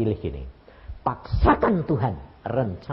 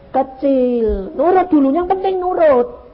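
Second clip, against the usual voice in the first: much higher and louder, driving the point home; emphatic preaching; 13 semitones higher, 7 dB louder, slower than usual. Real, not acted. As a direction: press obedience on the crowd. Preacher stressing obedience. (tekanan khotbah yang lantang)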